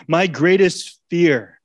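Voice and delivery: slow, loud stress on greatest; preacher slowing down to stress greatest; slow emphasis. Real, not acted. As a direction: lean hard into greatest, slowly. Slow emphatic sermon point.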